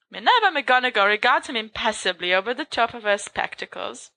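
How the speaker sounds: usual speaking voice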